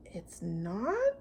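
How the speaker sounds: soft puzzled question; quiet surprise. Acted. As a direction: wonder quietly. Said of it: "thought Corduroy".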